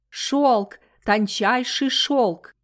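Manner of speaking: loud, drawn-out market cry; vendor calling out. A narrator's reading. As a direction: call out to passing buyers. Market vendor hawking silk.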